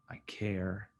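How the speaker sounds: soft whisper; whispered care. Shown as a demonstration. Dad comforting his daughter in a whisper. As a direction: breathe it gently to her. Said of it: "let her know that I'm there".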